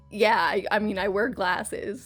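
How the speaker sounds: usual speaking voice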